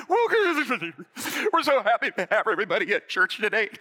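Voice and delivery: high, loud, panicky welcome; nervous fake cheer. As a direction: stretch out a greeting in mock terror. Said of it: "fearless and bold, but I'm like".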